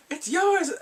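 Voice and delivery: spring the joke; high playful reveal. Real, not acted. Teasing punchline of pregnancy.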